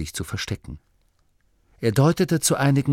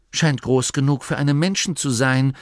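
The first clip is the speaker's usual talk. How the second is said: higher-voiced musing remark; nachdenklich; 6 semitones higher, 4 dB louder than usual. A narrator's reading. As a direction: think aloud, weighing it up. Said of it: "sagte die junge Nomi nachdenklich". (leicht nachdenklich, etwas höhere Stimme)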